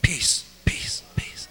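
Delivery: breathless whisper, fading; mock self-soothing whisper; whispered peace. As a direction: whisper it to yourself to calm down. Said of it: "And you go".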